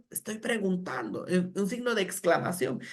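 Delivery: ordinary talk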